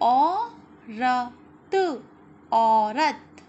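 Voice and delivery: मोर loud, the second slowly drawn out; read-along word. Shown as a demonstration. Teacher reading words aloud for children to repeat, slow and loud. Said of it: "अब यहाँ देखिए".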